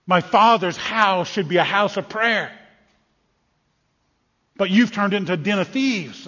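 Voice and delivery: loud angry voice. Acted. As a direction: scream out a rebuke to the crowd. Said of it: "I hear a loud, angry voice".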